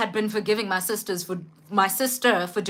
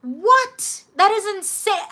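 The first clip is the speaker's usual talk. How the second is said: shocked disbelief; 9 semitones higher, slower than usual. Retold or imitated. Telling a friend's claim about her sister, recreating her shocked reaction. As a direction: throw the pitch way up in shock. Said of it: "And I was just like".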